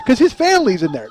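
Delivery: ordinary talk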